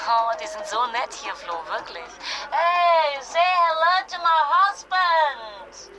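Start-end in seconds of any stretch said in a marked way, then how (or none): 2.5-5.9 loud, slow, sing-song English call; tipsy excited call. Acted. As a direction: call out giddily to the room. Beschwipst, ruft Leuten auf Englisch. (beschwipster, lauter Ruf in langsamem Englisch)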